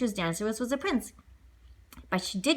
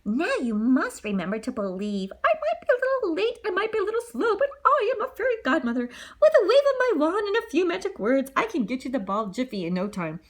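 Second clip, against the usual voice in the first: put-on high, doddery sweet old lady voice; fairy godmother promising Cinderella the ball; 7 semitones higher than usual. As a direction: potter along like a kindly old fairy.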